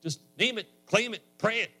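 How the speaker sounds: high, insistent prosperity-preacher imitation. Preacher imitates television evangelists promising money, high and insistent. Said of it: "The people that go on television and say".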